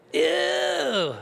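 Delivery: high, drawn-out mock disgust. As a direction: recoil at the bug photo. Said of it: "She calls them skates".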